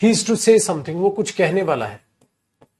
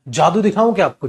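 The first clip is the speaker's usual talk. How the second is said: normal talking voice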